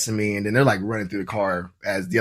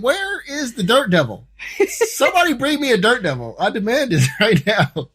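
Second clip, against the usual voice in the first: mock indignant demand; 11 semitones higher than usual. Car owner mockingly demands officers vacuum the dog hair. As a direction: demand a vacuum like royalty, laughing.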